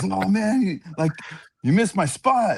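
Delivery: high-pitched imitated grumble; mock whining complaint. Ref complains to the announcer about his missed spot, mock grievance.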